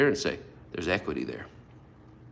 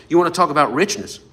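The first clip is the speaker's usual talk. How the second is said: louder, faster restart; 4 semitones higher, 7 dB louder, faster than usual. Real, not acted. Preacher to his congregation, a fired-up restart. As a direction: punch "You wanna talk about richness?" harder and quicker. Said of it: "I gotta stop talking".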